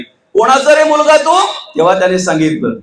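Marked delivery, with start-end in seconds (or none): 0.0-1.5 high, scornful taunt; haughty king questioning the boy; mocking demand. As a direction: sneer at the young envoy. Ravana taunts Angad.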